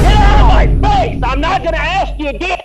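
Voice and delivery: high, loud furious shout; calm turning angry. Real, not acted. Officer finally shouts at man.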